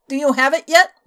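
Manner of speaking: loud, pressing demand; impatient. Acted. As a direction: push him for it.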